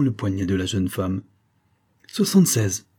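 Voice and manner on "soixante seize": higher and louder, delighted outburst; exclaiming. A narrator's reading. Doctor exclaiming the pulse count, excited. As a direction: announce the number with glee. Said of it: "s'exclama-t-il après un silence".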